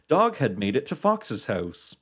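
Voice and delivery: plain reading voice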